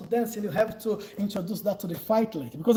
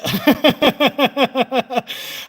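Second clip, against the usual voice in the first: loud open laugh; big friendly laugh; hearty laugh; 3 semitones higher, 7 dB louder than usual. Real, not acted.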